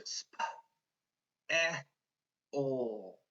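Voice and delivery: SP, EH, OL with a pause after each; finger-spelled sounds. Shown as a demonstration. Teacher finger-spelling spell, sounded out. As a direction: sound out SP, EH, OL one by one. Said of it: "When I finger spell spell, I hear".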